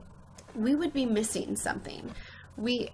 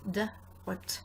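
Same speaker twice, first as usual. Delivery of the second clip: usual speaking voice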